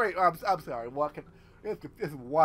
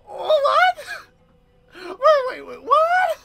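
baffled what; 12 semitones higher, 5 dB louder, much slower than usual. Real, not acted. Baffled by plot.

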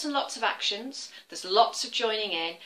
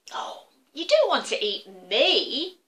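pleading little goat; 4 semitones higher, 6 dB louder, slower than usual. Acted. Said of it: "said the little Billy Goat Gruff".

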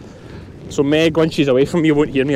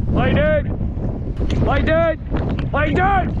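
high, carrying dog command called across the hill; calling to dogs; 10 semitones higher, much slower than usual. Real, not acted. Shepherd calling his dog to lie down, high and carrying. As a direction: call it out so the dog hears you far off.

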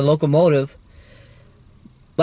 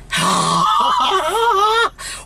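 overjoyed cry-laugh; 14 semitones higher than usual. Real, not acted. Railfan overwhelmed by the train, joyous yell.

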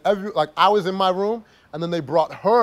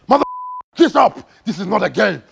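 retold gunman yell; 3 semitones higher, 4 dB louder than usual. Gunman yelling at sleeping Anthony.

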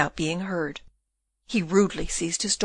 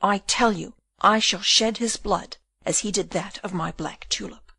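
usual speaking voice

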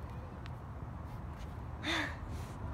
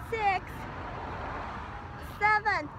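doing push ups; 5 semitones higher than usual. Real, not acted. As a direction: count out each push-up with effort. Counting aloud during push-up challenge.

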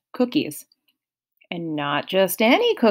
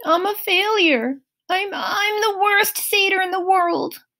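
higher, mild wail; a touch of self-pity; lightly voiced tearful Grover; moaning; 12 semitones higher than usual. A narrator's reading. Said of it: "He moaned".

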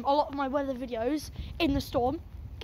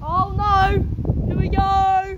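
high loud cries in a gale; shouting over roaring wind; caught in strong wind; 6 semitones higher, 6 dB louder, slower than usual. Real, not acted. Getting windy now, blown about.

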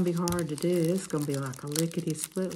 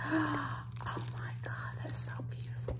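awed whisper, higher; mock-awed whispered quote; sister's gasp; 10 semitones higher, 6 dB quieter than usual. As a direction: imitate the sister breathless with wonder. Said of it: "my sister and she said".

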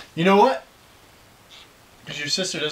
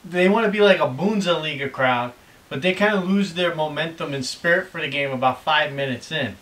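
ordinary delivery

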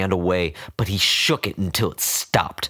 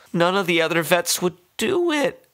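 put-on doting old woman; 11 semitones higher than usual. A narrator's reading. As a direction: coo and scold like a doting owner.

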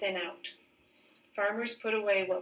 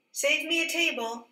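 much higher, louder call across the market; 8 semitones higher, 4 dB louder than usual. Acted. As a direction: call after her as she walks away. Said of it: "calls Leo".

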